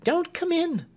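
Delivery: high, pleading woman's voice; a scared maid begging him not to enter; frightened maid. Acted. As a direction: push him away with a high anxious plea. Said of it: "she answered, pushing him back".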